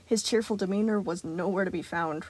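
usual speaking voice

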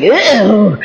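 long mock "ew"; disgusted. Preacher imitating Captain Man's reaction, mock disgust. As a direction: imitate a grossed-out onlooker. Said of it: "Captain Man looked and was like".

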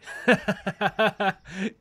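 a high, delighted laugh at the idea; amused laugh. Acted. Fae captor laughing at the captive's fear, amused high laugh.

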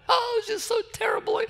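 high, quiet crying voice; mock sobbing woman. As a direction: whimper it like a weeping lady.

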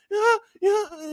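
small, high groggy reply; woken pupil. Retold or imitated. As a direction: imitate a kid just woken up. Sleepy pupil woken by teacher.

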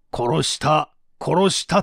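ordinary talk, nothing marked

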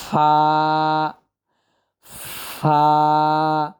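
ফা with lots of breath pushed out; Arabic fa. Shown as a demonstration. Teacher showing students the letter fa, airy and breathy. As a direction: breathe the ফা out strongly between teeth and lip. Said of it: "বাতাস ছাড়তে হবে বেশি পরিমাণ".

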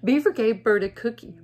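ordinary delivery, nothing marked

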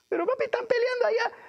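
put-on very high-pitched little kid voice; put-on child's falsetto. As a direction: squeak like an alarmed child calling to papi.

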